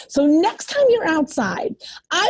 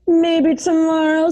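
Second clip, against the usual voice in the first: slow, put-on bluebird voice; 3 semitones higher, slower than usual. A narrator's reading. Bluebird answers the worm, slow put-on bird voice. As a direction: draw it out like a hopeful bird. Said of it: "says the bluebird".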